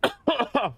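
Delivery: high strained cough; choking cough. Real, not acted. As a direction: cough from spicy burn. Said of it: "I can't speak".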